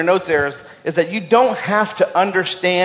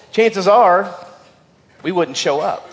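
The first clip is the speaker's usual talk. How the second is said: normal talking voice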